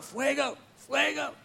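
imitating praying crowd. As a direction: chant it softly, as if heard from afar. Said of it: "they're saying it over and over".

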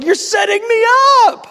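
high, loud wailing protest; mock outraged shout. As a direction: cry out as if trapped, playing it big. Acted protest to God.

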